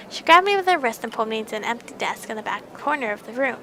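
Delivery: usual speaking voice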